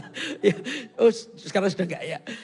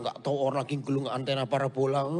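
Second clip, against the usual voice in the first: ordinary talk, nothing marked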